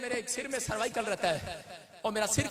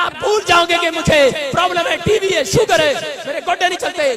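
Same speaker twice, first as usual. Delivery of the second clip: mock whiny complaints; 6 semitones higher, 10 dB louder than usual. Mocking complaints about ailments.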